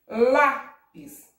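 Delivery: Lápis with long, loud LÁ; stress on LÁ. Shown as a demonstration. Teacher sounding out the accent, loud LÁ in Lápis. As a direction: punch and hold LÁ in Lápis. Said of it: "acento agudo".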